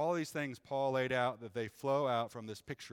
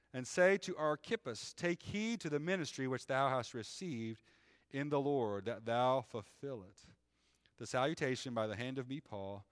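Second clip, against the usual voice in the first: measured, raised reading voice; scripture reading; 2 semitones higher, 4 dB louder than usual. Acted. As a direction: read it like the pulpit Bible. Reads scripture aloud.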